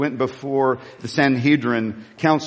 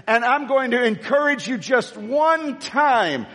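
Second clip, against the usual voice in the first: slow preaching build, climbing higher; fervent exhortation; 8 semitones higher, slower than usual. Real, not acted. Preacher urges congregation to shout to the Lord, building fervour. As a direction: stretch the key words, building toward a shout.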